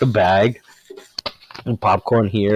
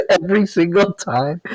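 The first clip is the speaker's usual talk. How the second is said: higher-pitched, through real laughter; laughing; 9 semitones higher than usual. Real, not acted. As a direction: laugh your way through the words. Laughing over retold outtake.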